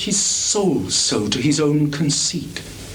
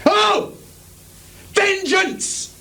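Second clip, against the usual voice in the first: anguished cry; much slower than usual. Acted. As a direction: scream it to the heavens. Climax cry for revenge.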